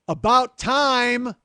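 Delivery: drawn-out, high, loud "About time!"; impatient yell. Retold or imitated. Customer yelling through the door, impatient loud yell. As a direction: yell it slow and annoyed. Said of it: "somebody yell".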